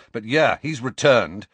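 angry. A narrator's reading. Student Harry insisting the dark wizard returned, angry. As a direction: insist it with heat. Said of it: "said Harry angrily".

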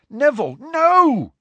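high, drawn-out loud cry; urgent shout. A narrator's reading. Harry stopping Neville's charge at Malfoy, shouting. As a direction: shout in alarm to stop him.

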